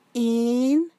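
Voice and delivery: high, startled little "Én?"; accused penguin asks back, startled; surprised. A narrator's reading.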